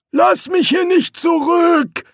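high, drawn-out begging cry; slow, high-pitched pleading; desperate plea. Acted. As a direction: beg them not to leave you. Trapped voice begs not to be left.

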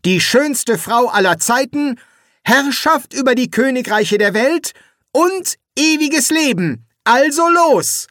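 high, loud, slowing proclamation; grand demands. A narrator's reading. Eric demanding his three wishes, high and grand.

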